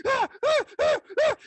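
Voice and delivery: string of high mock yelps; hammed-up speechless shrieking; panicked gasps. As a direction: gasp out short, high helpless cries.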